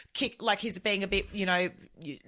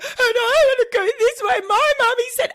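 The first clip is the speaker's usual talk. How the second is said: put-on crying toddler; 9 dB louder than usual. Toddler wailing to stranger.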